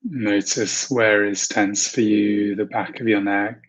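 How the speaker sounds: calm, unhurried meditation voice with long pauses; guided meditation. Acted. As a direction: ease listeners gently into relaxation, leaving space to breathe. Leads a guided visualization.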